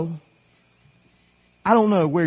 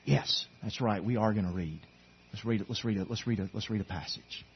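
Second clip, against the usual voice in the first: soft reply; 6 semitones lower, 11 dB quieter than usual. Retold or imitated. Replying to wife about reading.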